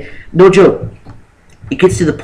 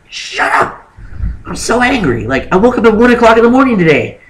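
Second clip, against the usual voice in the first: much higher, strained mock outburst; mock angry snap; 9 semitones higher than usual. Imitating the grumpy hiker she was after waking at one, pitched-up mock rant. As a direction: snap at everyone like an overtired hiker.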